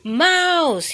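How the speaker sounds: loud call for Mouse; frightened cry. A narrator's reading. Bear scared in the dark, loud frightened cry. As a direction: call out, startled. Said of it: "he cried".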